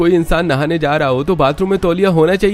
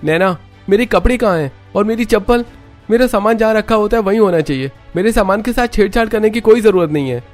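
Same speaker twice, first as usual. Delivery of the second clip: loud, much higher, harsh and commanding; harsh stern voice; 11 semitones higher, 4 dB louder than usual. Acted. Husband home from office scolds wife, harsh loud shout. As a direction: bark orders at the wife. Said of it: "Rohan की कड़क आवाज".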